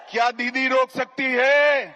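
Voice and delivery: booming political rally speech. Real, not acted. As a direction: boom it out to a packed rally.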